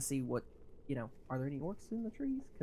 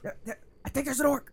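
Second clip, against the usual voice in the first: excited; 10 semitones higher, 6 dB louder than usual. Acted. Pointing out the orc.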